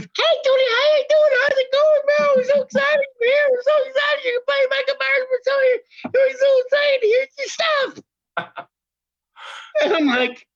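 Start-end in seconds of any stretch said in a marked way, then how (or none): 0.0-8.7 very high-pitched, over-excited squeal of a greeting; gushing welcome. Retold or imitated. Woman greets the newly arrived actor, imitated in a high gushing voice. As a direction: gush in a high mock voice, all thrilled.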